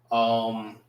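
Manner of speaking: ordinary talk, nothing marked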